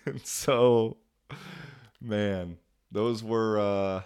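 ordinary talk

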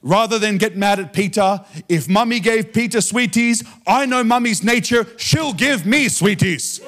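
high, building preacher's retelling; Reinhard Bonnke. Retold or imitated. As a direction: build to a slow, triumphant punchline. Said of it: "And he said to himself".

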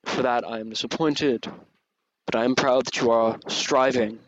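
loud, grave divine judgment; a disappointed yet proud god, booming; booming dragon god. Acted. Dragon god continues judging player.

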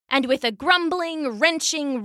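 ordinary delivery, nothing marked

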